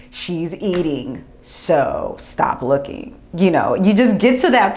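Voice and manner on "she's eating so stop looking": slow, lowered, deliberate retort; firm dismissal. Retold or imitated. Breastfeeding mother to staring onlookers, slow and firm. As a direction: spell it out slowly to the gawkers.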